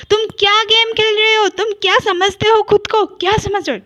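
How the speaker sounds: high, loud angry shout; furious high yell at her sister. A narrator's reading. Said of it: "चिल्ला के कहता है".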